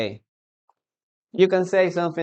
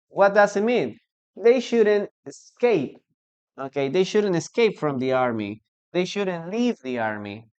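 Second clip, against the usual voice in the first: normal talking voice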